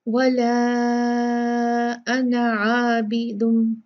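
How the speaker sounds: very slow chanted tajweed recitation; Quranic recitation. Shown as a demonstration. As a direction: recite it, drawing out the madd. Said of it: "देखें, मैं पढ़ती हूँ ना". (धीमी तजवीद वाली तिलावत)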